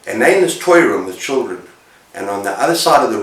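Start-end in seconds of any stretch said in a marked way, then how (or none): none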